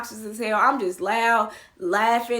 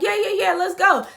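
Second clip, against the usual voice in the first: high, loud-ish keen yeses; eager agreement; 9 semitones higher, 4 dB louder than usual. Retold or imitated. Drunk woman agreeing to go elsewhere, eagerly. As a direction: pipe up, game for anything.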